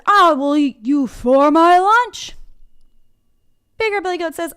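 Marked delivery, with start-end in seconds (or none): 0.0-2.3 loud, drawn-out troll roar; menacing troll. Acted. Troll threatens the little billy goat, slow loud troll voice. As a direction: bellow it slowly like a hungry storybook troll. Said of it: "Troll says".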